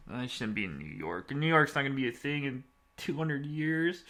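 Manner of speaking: ordinary delivery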